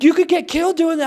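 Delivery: high-pitched retold warning; alarmed mom. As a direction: imitate a worried mother's warning.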